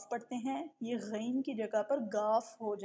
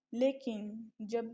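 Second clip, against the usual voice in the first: normal talking voice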